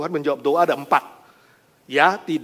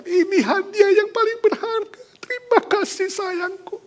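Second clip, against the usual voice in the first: much higher, delighted thanks; wife thanking husband, overjoyed; overjoyed gratitude; 6 dB louder than usual. Acted. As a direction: gush with joy.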